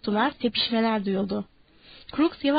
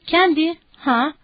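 higher, louder, drawn-out call; calling after; 5 semitones higher, 5 dB louder, slower than usual. A narrator's reading. Crooks calling Candy as he leaves, calling after. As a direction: slowly call someone back. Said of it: "Crooks seslendi".

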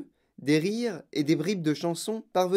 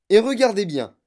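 loud, high showman's command; ordre appuyé; 8 semitones higher, 10 dB louder than usual. A narrator's reading. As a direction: demand attention in a raised voice. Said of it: "dit monsieur Wonka". (injonction forte et aiguë)

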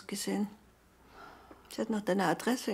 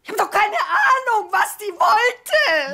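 shouting, much higher and louder; angry outburst; 12 semitones higher, 13 dB louder, slower than usual. Acted. Mother yelling at her child, angry shout. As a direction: lash out, drawn out slowly.

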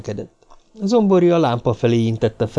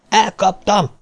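high cry of victory; triumphant shout; 10 semitones higher, 3 dB louder, slower than usual. A narrator's reading. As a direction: cry out high that you've won. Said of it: "ordította aztán diadalittas hangon".

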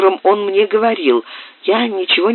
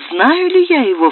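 joyful exclamation; 7 semitones higher than usual. A narrator's reading. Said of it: "вскричала я с радостными слезами".

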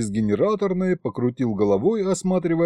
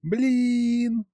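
put-on tiny baby voice; 10 semitones higher than usual. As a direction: say Блин as a swaddled infant's first word. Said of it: "донеслось тихо".

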